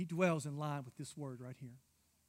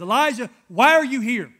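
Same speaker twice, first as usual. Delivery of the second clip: loud, high-pitched challenge to Elijah; loud demand; 7 semitones higher, 19 dB louder than usual. Retold or imitated. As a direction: call out the question, hammed-up and booming. God confronts Elijah, preacher retelling.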